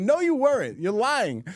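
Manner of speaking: put-on high little-girl protest; imitated upset child. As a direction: accuse like a tearful small daughter, mimicked. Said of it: "like, crying".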